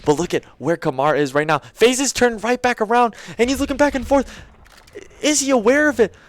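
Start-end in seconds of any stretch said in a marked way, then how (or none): 1.7-6.1 rising, louder hype call; esports caster. Real, not acted. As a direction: build tension into the kill. Live play-by-play cast.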